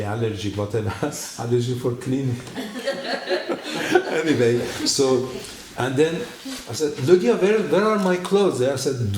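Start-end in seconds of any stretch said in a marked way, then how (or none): 2.4-4.0 breathy high giggle. Real, not acted. Laughs at his own allergy. 7.1-8.6 loud high call across the house. Retold or imitated. Man calls to his hostess for his clothes, loud and high.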